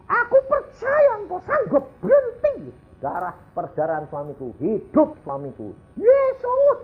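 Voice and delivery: high frantic cries to Jesus, voice cracking; hysterical screaming. Retold or imitated. Wife at her husband's ICU bed, shrieking hysterically. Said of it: "dia teriak-teriak kayak orang histeri".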